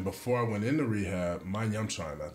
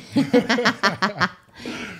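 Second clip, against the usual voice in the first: loud high laugh at himself; laughing at his own realization; big burst of laughter; 13 semitones higher, 11 dB louder than usual. Real, not acted. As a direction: crack up hard. Punchline of therapy story.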